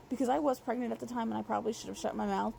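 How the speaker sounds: usual speaking voice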